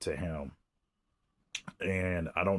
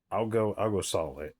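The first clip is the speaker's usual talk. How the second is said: normal talking voice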